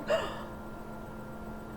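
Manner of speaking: sharp breathless gasp; mock shocked gasp. Suki's shocked reaction retold.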